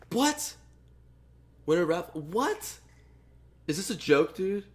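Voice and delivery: sudden high yelp of real surprise; can't believe what just popped up; stunned disbelief. Real, not acted. Giveaway result comes up wrong.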